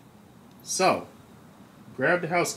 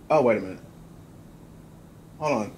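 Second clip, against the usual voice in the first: ordinary delivery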